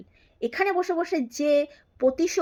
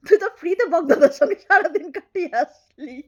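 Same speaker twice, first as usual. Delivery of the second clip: louder, raised accusing line; loud accusation; 3 semitones higher, 6 dB louder than usual. Real, not acted. Vlogger loudly accusing her rival of a scandal. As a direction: fling the charge out hard.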